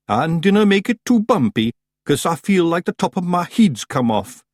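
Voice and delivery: put-on high, loud Scottish accent, dazed; woozy Scots pixie; Scottish Feagle. A narrator's reading. Daft Woolly Scots.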